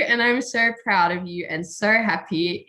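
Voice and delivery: ordinary talk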